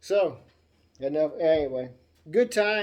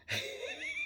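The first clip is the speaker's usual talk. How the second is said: high-pitched giggling cackle; real laugh; 6 dB quieter than usual. Real, not acted. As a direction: burst out giggling at your own story. Laughs at his own story.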